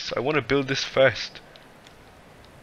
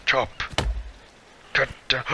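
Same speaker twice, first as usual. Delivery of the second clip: ordinary delivery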